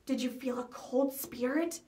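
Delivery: slow, eerie ghost-hunter tone; spooky ghost-story voice. A narrator's reading. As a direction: draw it out like a campfire tale. Friend asks about ghosts.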